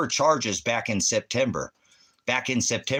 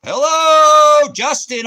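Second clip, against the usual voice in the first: much louder, high shouted hello; 13 dB louder, much slower than usual. Real, not acted. Streamer shouting at a silent viewer, loud shout. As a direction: bellow the greeting to get an answer.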